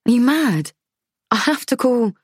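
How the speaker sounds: high, urgent protest; alarmed disbelief. Acted. Narrator shocked protest.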